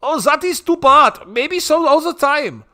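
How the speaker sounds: high, loud mock-European accent; hammed-up disappointment in a high foreign voice; Bebe. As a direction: sing out the letdown, high and loud. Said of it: "He seemed disappointed".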